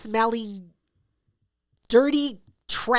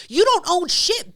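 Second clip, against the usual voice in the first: defiant; 8 semitones higher, 4 dB louder, slower than usual. Retold or imitated. Defiant rant at bartender.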